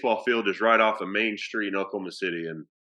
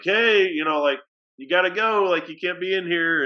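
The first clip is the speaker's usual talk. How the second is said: calling out; 6 semitones higher, 3 dB louder than usual. Retold or imitated. Telling intruder to leave.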